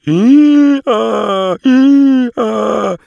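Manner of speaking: high, loud, pitiful hee-haw; wailing donkey bray. A narrator's reading. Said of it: "schickte ein jämmerliches".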